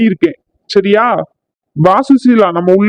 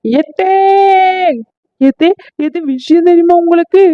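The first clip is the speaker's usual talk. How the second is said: urgent call; 8 semitones higher than usual. Acted. Daughter-in-law rushes in upset.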